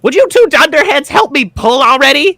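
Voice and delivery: a tiny jailer yelling at useless guards; exasperated gnome. Acted. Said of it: "the gnome says".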